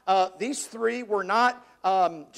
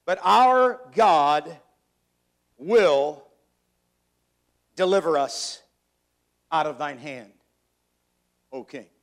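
dramatic scripture reading; slower than usual. Acted. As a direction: read it like a solemn vow of defiance. Preacher reads the defiant answer.

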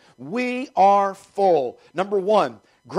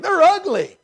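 mock disgust; 12 semitones higher, 7 dB louder than usual. Spies describing the giants.

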